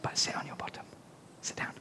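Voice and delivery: whispered instruction; whispered direction. Real, not acted. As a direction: whisper it to the helper. Whispered stage direction to child.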